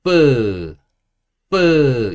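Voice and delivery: loud, drawn-out F with a vowel after it; English F sound. Shown as a demonstration. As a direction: stretch out the F in 뿌, loudly. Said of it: "F 소리가 어떤 소리가 나냐면".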